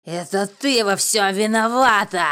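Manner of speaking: very loud, drawn-out accusation; enraged yell; furious shout. A narrator's reading. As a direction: bellow the blame slowly at full force. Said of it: "выкрикнул Славик".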